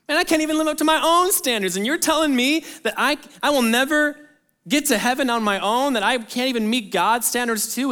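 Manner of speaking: high, fast, exasperated outburst; exasperated despair. Pretend. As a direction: blurt it out like someone who's hopeless. Voicing a despairing believer.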